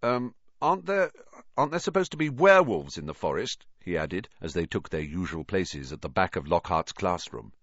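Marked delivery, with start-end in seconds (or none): 0.0-3.7 higher, faltering and rushed, tripping over the words; a boy fishing for reassurance, voice wobbling up; nervous stammer. A narrator's reading. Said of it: "twirling his wand nervously".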